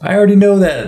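put-on kids teasing. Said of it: "They're like".

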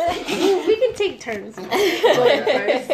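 loud, slow laughing words; laughing while talking. Real, not acted. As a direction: talk through laughter. Guest laughing while suggesting.

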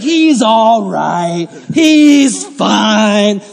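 mock owner, very slow and drawn out; dismissive dog owner. Dog owner excusing her biting dog, slow mocking drawl. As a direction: stretch every word, mocking her excuse. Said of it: "she was just like".

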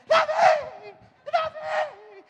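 high, loud frantic calling; mother's frantic shout. Retold or imitated. Preacher playing the mother calling her son across the club, high frantic shout. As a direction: scream the boy's name like a panicked mother. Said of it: "E a mulher".

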